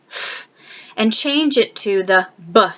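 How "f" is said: soft lone f sound; just breathe the f of fox; the f sound alone; isolated sound. Shown as a demonstration. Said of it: "change the first sound in fox".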